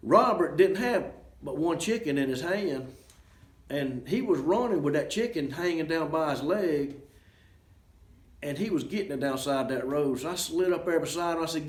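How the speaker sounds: ordinary delivery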